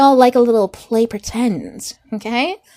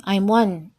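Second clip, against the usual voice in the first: normal talking voice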